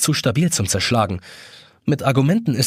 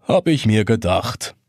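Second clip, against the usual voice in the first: slow, hollow-sounding reproach; reproachful hollow voice; 3 semitones lower, slower than usual. A narrator's reading. Glass dome answering the trapped boy, hollow reproachful voice. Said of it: "antwortet vorwurfsvoll eine hohlklingende Stimme".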